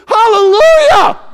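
high, loud, drawn-out shout of praise; joyful hallelujah shout. Shown as a demonstration. Preacher leading the congregation in praise, a high loud jubilant shout. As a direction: cry it out like a jubilant worshipper. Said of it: "See, there's the hallelujahs".

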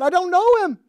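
very high, loud denial; frantic denial. Retold or imitated. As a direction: blurt it out in panic. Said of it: "He said".

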